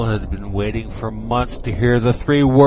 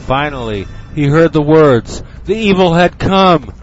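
rising louder and higher to climax; building dramatic narration; 8 semitones higher, 11 dB louder than usual. A narrator's reading. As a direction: build the tension to a shout. Narration builds to the evil arriving.

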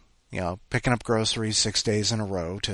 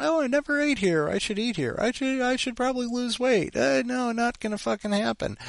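much higher, silly put-on voice; mock inner voice; 13 semitones higher than usual. His inner glutton eyeing new restaurants, silly high voice. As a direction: voice a greedy little inner self.

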